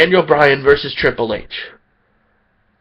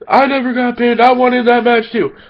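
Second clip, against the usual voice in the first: whiny Batista; 7 semitones higher than usual. Retold or imitated. Batista complaining he never got pinned, high whiny heel voice. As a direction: play Batista as a whiny heel crying foul. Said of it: "Being the typical heel that he is".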